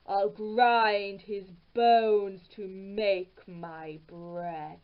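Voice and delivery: slow, heavy giant's threat; menacing ogre voice. A narrator's reading. Ogre threatens the hidden Englishman, slow and heavy. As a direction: growl it out slowly like an ogre.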